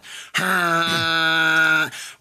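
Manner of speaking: a loud burst of real laughter; a hearty outburst of laughter. Real, not acted. Laughing at the neighbors' horn.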